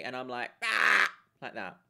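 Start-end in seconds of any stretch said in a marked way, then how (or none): 0.6-1.1 short witchy shriek of a laugh; sudden high witchy "Ah!"; witch cackle. Shown as a demonstration. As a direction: demo a possessed witch's cackle. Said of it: "a witch possesses me".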